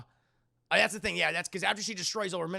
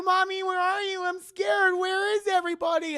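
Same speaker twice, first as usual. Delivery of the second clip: put-on high, loud scared little girl; Charlie; 13 semitones higher, 7 dB louder than usual. Possessed mother calling for Mommy, scared child voice. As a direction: wail like a frightened child. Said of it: "Charlie has somehow possessed Toni Collette".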